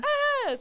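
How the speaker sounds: short high-pitched mock scream; a loud, high yelp of retold panic. Retold panic when caught.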